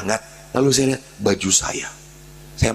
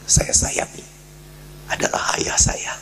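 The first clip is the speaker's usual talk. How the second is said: whispered confession. Real, not acted. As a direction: hush it like a secret.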